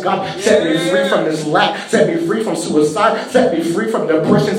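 ordinary talk

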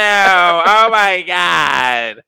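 high, loud, slow put-on whiny girl; impression of Samantha. Imitating Samantha scolding Marquise, high slow whine. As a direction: drag it out like the friend scolding him. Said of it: "That is how she sounds".

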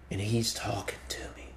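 whispered, as if into an ear; hushed whisper. Acted. Imagined believer awed that God is talking to him, whispering. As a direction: drop to an intimate whisper. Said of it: "he's whispering sweet nothings in my ear".

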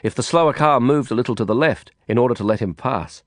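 usual speaking voice

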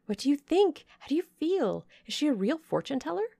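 put-on high, rushed girlish questions; breathless with curiosity; eager demanding. The Eshton sisters pester Blanche, high and rushed. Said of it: "demanded the Misses Eshton".

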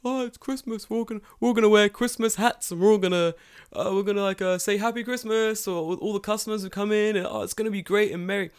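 high, sing-song holiday cheer; mock festive cheer. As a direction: gush like a too-cheerful coworker. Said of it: "everyone's like".

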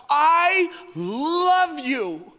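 high, very drawn-out declaration; big swooning love declaration; emphatic, passionate. Acted. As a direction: stretch every word with hammed-up passion. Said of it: "it comes out emphatically and passionately".

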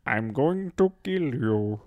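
slow, badly faked Swedish that sounds British; measured threat in posh British-sounding mock Swedish; attempted Swedish accent. Shown as a demonstration. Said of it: "it's very British sounding".

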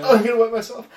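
high, loud laughter; laughing so hard it hurts. Real, not acted.

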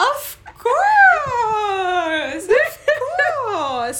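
loud, high shout through real laughter; laughing exclamation. Real, not acted. Guest admits she dates Germans, laughing loudly. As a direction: crow it out while laughing, very high. Laughing answer to dating question.

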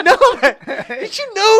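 a laughing squeaky imitation; squeaky little voice. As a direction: do Bico's tiny squeaky voice. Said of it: "Ele tem essa vozinha?".

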